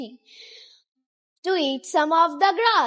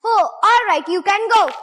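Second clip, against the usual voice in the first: higher, louder grudging troll; troll reluctantly lets the goat go, higher and louder; grumbling troll; 6 semitones higher, 5 dB louder, faster than usual. Acted. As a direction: let him pass like a sulky troll. Troll gives in.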